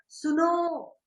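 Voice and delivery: सुनो called out with a held ओ; calling out suno. Shown as a demonstration. Teacher showing how we call someone, calling out. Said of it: "तो वो आवाज देते हैं ना".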